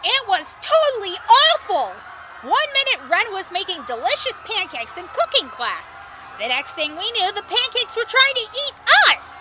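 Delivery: very high, frantic storytelling; frantic distress. Acted. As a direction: squeal the horror of it, peaking at the end. Frantic account of escaping monsters.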